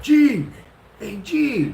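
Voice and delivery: high, loud call across the house. Retold or imitated. Naked husband calls his wife from the bar, calling out high.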